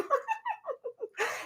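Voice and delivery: very high, soft squealing laugh. Retold or imitated. Girls at dinner laughing at his jokes, high squeal. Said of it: "we all go".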